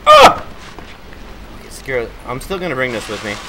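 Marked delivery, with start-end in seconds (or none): 0.0-0.5 high loud startled cry; startled scream. Real, not acted. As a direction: yelp in sudden fright.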